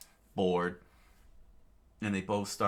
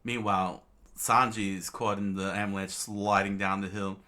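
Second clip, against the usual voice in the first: ordinary delivery, nothing marked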